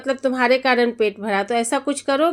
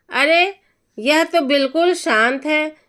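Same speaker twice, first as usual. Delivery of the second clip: usual speaking voice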